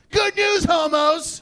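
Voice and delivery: high, loud parade-ground announcement; drill sergeant shout. Retold or imitated. Drill sergeant announces news to trainees in formation, shouting. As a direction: call out to the whole formation as a sergeant.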